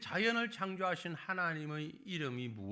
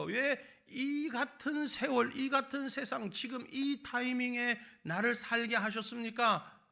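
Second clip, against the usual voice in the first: pleading complaint; 9 semitones higher, 4 dB louder than usual. Retold or imitated. Believers questioning God.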